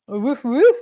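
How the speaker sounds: loud barking woof woof in a put-on dog voice; French dog bark. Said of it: "a French dog".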